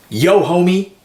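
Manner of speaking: high, loud street call-out; imitated mugger's shout. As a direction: holler after someone walking away. Mugger calling out to the storyteller.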